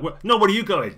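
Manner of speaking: high, loud protest; alarmed demand. Acted. Woman to someone slipping away, loud and high.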